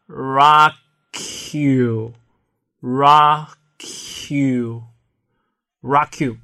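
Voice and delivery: "rock you" run together so the k glides into the you; linked rock you. Shown as a demonstration. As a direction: say "rock you" loud and blended as one word. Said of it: "于是两个词连读后就变成了".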